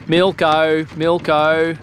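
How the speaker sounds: a hint of a milkman's call; lightly voiced milkman calling down the street; street vendor calling. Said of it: "a man calling".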